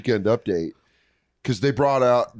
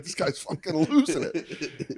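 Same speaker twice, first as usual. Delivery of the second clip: dog voice; 3 semitones higher, 6 dB quieter, slower than usual. Retold or imitated. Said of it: "Your dog's like".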